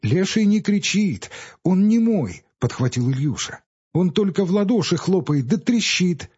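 lightly voiced knowing boy; a hint of a serious boy; boy's voice. A narrator's reading. Ilyusha explains the leshy.

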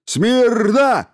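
high, loud barked order; barked command. Acted. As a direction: bark it like a drill sergeant. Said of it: "гаркнул он". (гаркнуть команду)

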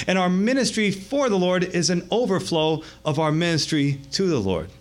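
usual speaking voice

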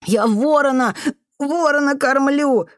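put-on high child's voice, broken and drawn out; sobbing boy. A narrator's reading. Boy explaining himself to the janitor, sobbing. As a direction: whimper it between sobs. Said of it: "всхлипывал мальчик".

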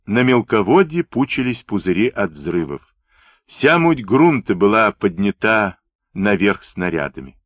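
ordinary delivery, nothing marked

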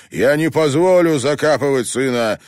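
loud and high, refusing the burial; desperate shout. A narrator's reading. Father refusing to let his son be buried, loud and desperate. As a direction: yell it at the mourners. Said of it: "закричал Тони Глик".

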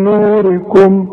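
نوركم with the long u held two counts, slow; natural madd. Shown as a demonstration. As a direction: stretch the u in نوركم. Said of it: "شوف المدود". (مد الواو في نوركم)

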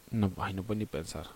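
usual speaking voice

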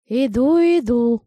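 slow, loud toothless mumble; mumbling old woman. A narrator's reading. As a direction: call out slowly, mumbling like an old lady. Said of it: "прошамкал голос". (шамкающий старушечий голос)

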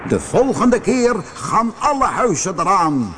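high-pitched, lightly roared threat; Roodkop roaring. A narrator's reading. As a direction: threaten like a mountain, half roaring. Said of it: "brulde Roodkop". (licht brullend dreigement)

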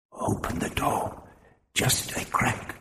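cautious whisper; hushed, careful proposal; whispered suggestion. A narrator's reading. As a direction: suggest it under your breath. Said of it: "Propillus suggested".

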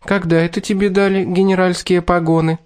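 high teasing sultry voice; femme fatale. Acted. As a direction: purr it like a seductress. Kat teasing Morton.

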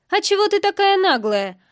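high, loud aggressive question; confrontational challenge. A narrator's reading. Woman advances on the heroine, confrontational. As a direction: square up to her indignantly.

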